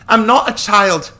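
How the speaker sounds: put-on a girl insisting she's grown up; defiant shout. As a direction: fling it at him in proud defiance. Lisha defiant after shouting.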